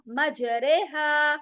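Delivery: मज रेहा drawn out slowly with रे as "re"; correct recitation. Shown as a demonstration. Teacher slowly modelling मज रेहा for students. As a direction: stretch मज रेहा, the रे tilted toward e. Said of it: "री नहीं कहेंगे इसको हम".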